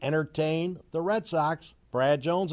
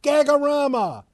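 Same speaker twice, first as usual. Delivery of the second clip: much higher and louder gleeful hollering; mocking shouts; 12 semitones higher, 9 dB louder, slower than usual. Real, not acted. Radio host gleefully mocks the Pirates' collapse with loud shouts. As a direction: yell it like a tree is falling.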